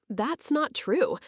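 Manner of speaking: usual speaking voice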